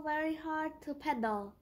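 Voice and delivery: ordinary delivery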